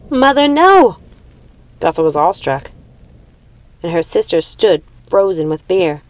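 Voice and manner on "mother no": high, loud cry of horror; horrified cry. Acted. Daughter crying out as her mother unleashes the dead, horrified. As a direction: scream it at your mother to stop her.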